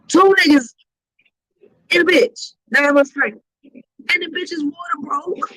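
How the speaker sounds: ordinary talk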